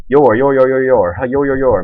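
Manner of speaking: lightly voiced babbling mockery of the friend; mocking imitation. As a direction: repeat "your" like a taunting kid, mildly. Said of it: "making fun of me".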